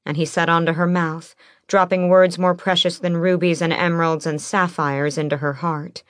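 ordinary delivery

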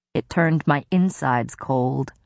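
usual speaking voice

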